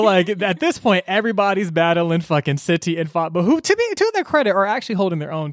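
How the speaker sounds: usual speaking voice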